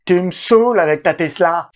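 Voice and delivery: exasperated wife, mock complaint; hammed-up fed-up wife; imitating annoyed wife. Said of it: "je l'imite pas très bien".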